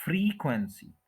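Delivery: Frequency with stretched FREE, falling after; stress on first syllable. Shown as a demonstration. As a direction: give the first syllable of frequency its full time. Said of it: "उसके ऊपर stress करेंगे".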